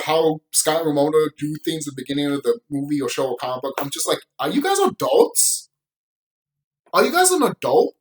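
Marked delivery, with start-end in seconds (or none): 4.4-7.9 incredulous. Real, not acted. Mocking question to immature characters.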